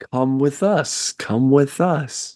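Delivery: high, slow, drawn-out beckoning voice; beckoning waves. Acted. Game master voicing waves calling to the player, beckoning.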